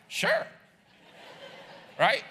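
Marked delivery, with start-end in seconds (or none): 0.1-0.5 "Sure" leaping very high, bright; much higher happy "Sure"; eager sure. Retold or imitated. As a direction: say "Sure" with real delight. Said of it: "Or is it like".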